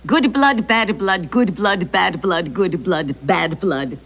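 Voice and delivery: quick, run-on tongue twister; three times fast. Shown as a demonstration. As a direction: rattle off "good blood, bad blood" without stopping. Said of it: "say three times fast".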